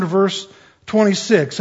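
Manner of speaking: normal talking voice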